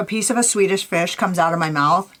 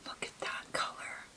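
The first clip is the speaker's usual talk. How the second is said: very soft, breath-only murmur of wonder; admiring whisper; 22 dB quieter than usual. Real, not acted. Vlogger marvelling at the eyeshadow color, hushed admiration.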